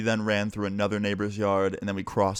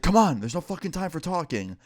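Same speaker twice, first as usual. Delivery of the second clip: urgent retold plea; 7 semitones higher than usual. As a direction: imitate a friend frantically hurrying someone along. Acted panicked friend rushing him.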